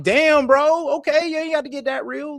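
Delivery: high squeal of disbelief; shocked laugh. Retold or imitated. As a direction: act stunned and amused. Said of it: "I'm like".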